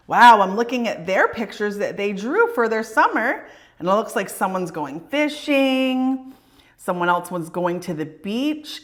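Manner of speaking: plain reading voice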